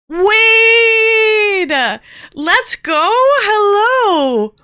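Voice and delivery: high, loud, drawn-out cheer; excited shouted greeting. Real, not acted. Streamer hailing Wade as he turns up in chat, excited shout. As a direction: holler the name in celebration. Hails an arriving viewer loudly.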